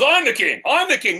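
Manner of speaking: high, insistent, hammed-up retort; arguing back. Beggar man arguing back and forth with the king, insistent. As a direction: squabble like a child claiming a title. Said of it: "They're arguing back and forth".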